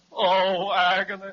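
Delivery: hammed-up agony; moaning. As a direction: lament it dramatically. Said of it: "stop your infernal moaning".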